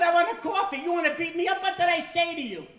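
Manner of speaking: high, loud, furious outburst; angry yell. Retold or imitated. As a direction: blow up at the barista. Fed-up customer yells at barista.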